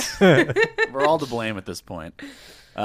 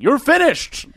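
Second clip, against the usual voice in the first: high, loud acted yell; sudden mock outburst; 10 dB louder, much slower than usual. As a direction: burst out shouting in character. Imagined outburst between film takes.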